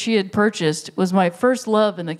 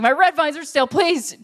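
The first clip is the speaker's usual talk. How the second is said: high-pitched, hammed-up begging; frantic pleading; 7 semitones higher than usual. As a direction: plead with the manager as if it's dire. Retold customer pleading to manager.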